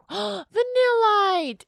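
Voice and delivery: high, drawn-out delighted cry; excited squeal. Real, not acted. Pulling a card, thrilled high cry. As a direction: squeal the name with joy.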